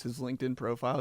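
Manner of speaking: usual speaking voice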